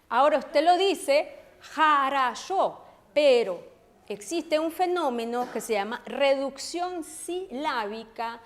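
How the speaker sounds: ordinary talk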